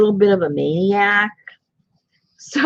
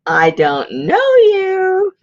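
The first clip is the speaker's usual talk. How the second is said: high, very slow teasing reply; high, very slow, playful rejection; mock brush-off; 13 semitones higher, 4 dB louder, much slower than usual. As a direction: drag it out like a sister pretending not to know you.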